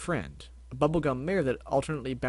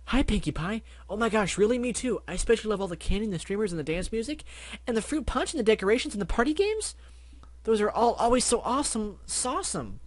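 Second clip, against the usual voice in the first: higher, fast, gushing party pony; excitable Barry Bubble; 9 semitones higher than usual. Acted. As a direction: tumble over your words in delight. Barry gushes about parties.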